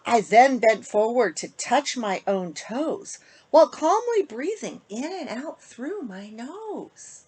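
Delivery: put-on lilting rhymed read-aloud for kids; storybook reading. A narrator's reading. Host reading a bedtime rhyme to kids, lilting. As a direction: read the rhyme to children, sing-song. Reads picture book to children.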